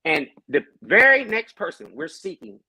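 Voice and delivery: normal talking voice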